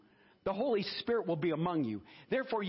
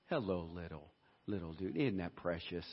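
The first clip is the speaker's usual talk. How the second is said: softer, much lower cooing hello to a little one; 12 semitones lower, 6 dB quieter than usual. Real, not acted. Pastor greeting a little one in the service, soft and tender.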